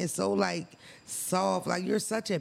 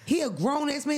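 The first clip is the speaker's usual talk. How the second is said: normal talking voice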